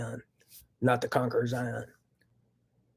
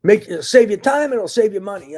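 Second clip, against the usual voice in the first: real anger; 7 semitones higher, 11 dB louder than usual. Real, not acted. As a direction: push every word out in real frustration. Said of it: "I'm just really angry right now".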